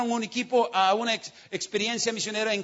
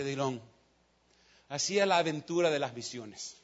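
low, soft and tender; a hint of God's gentle voice; 8 semitones lower, 5 dB quieter than usual. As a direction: speak softly like a hand on the shoulder. God speaks gently to preacher.

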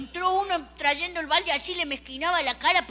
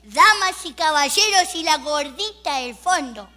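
slow, grand announcer's opening, louder; auctioneer voice; 4 dB louder, slower than usual. Retold or imitated. Auctioneer greets the room, slow and grand. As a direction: open the auction like a showman. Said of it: "Apareció un señor adelante de todo y dijo".

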